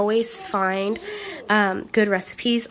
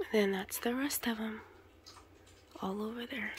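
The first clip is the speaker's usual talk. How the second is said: whispering; 16 dB quieter than usual. Real, not acted. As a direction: hush it while placing the gifts. Quiet moment wrapping gifts.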